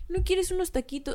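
mock dainty offer; sweet diminutive. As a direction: coo it like a Mexico City host. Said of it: "hablan en diminutivo".

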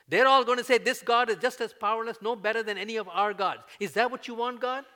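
loud, high, urgent challenge; impassioned pleading. Acted. Moses confronting God about his people, impassioned and loud. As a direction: hurl the questions upward. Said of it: "basically God is saying to Moses".

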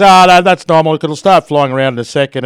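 mock reassuring handler, loud and breezy; falconer reassuring crowd. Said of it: "And he goes".

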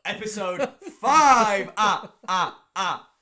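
can't stop laughing mid-sentence. Real, not acted. Laughing through intro.

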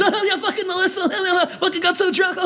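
high, laughing bro voice; mock gym bro. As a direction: cackle through the gossip like a meathead. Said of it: "all I hear is".

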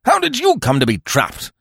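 high-pitched, indignant demand; wrathful, accusing question thrown high; unreasonable demand. A narrator's reading. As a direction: demand it as if it were their fault. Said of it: "he demanded unreasonably".

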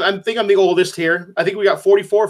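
usual speaking voice

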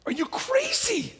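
very high, drawn-out disbelief; incredulous squeak. Acted. David's men reacting to his repentance, incredulous squeak.

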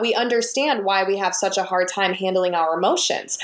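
normal talking voice

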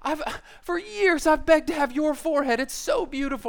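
high, over-the-top flattery; gushing girlfriend. Acted. Girlfriend praising her friend's forehead, gushing. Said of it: "you would just, just go full in on".

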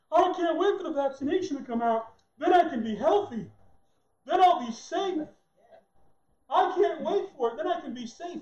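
high, loud, gushing naive voice; eager gullible person. Retold or imitated. Preacher mocking people eager for vaccine, high and gushing. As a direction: gush like a gullible fan.

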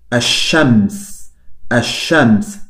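ash-shams with the L silent, straight into a doubled sh; silent lam. Shown as a demonstration. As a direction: say "ash-shams" slowly, no L sound at all. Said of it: "es mudo". ("ash-shams" con la lam muda, sin sonido de ele)

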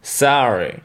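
American sorry; "Sorry" with an open A, "sahry"; American English. Shown as a demonstration. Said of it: "Pareil pour le mot désolé". (en américain, "sorry" avec un A ouvert)

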